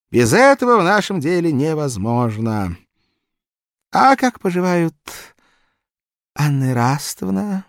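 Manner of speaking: high, fawning barber; obsequious barber. Acted. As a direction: ask like a servile barber. Barber reassures.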